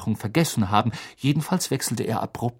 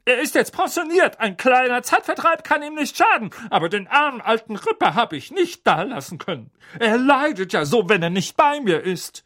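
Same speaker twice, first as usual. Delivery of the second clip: doting aunt; 11 semitones higher than usual. Acted. Aunt fussing over her dog Ripper, doting. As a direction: gush about the poor dog. Magda voiced in story.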